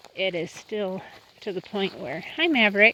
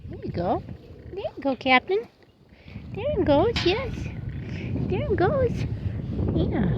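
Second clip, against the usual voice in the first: rising higher and higher, soothing; high coaxing; 11 semitones higher than usual. Real, not acted. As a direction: coax him gently in a climbing high voice.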